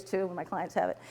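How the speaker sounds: normal talking voice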